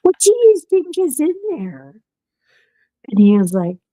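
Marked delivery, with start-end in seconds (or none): 0.0-2.0 higher, wary. Retold or imitated. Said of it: "And I was like".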